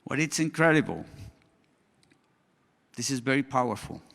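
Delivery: normal talking voice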